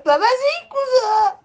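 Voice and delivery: high mock granny voice, half laughing; imitating old lady. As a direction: squeak it out like a granny. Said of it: "Elle, elle a fait".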